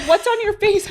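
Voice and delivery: put-on very high, loud kid question; imitating little son. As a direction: blurt it like a child, laughing. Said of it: "he's like".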